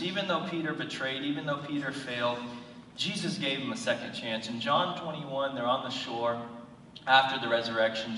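usual speaking voice

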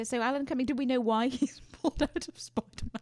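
real giggles breaking through the question; can't keep a straight face. Real, not acted. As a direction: try to ask it seriously and crack up. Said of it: "I can't say that with a straight face".

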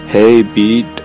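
ordinary talk, nothing marked

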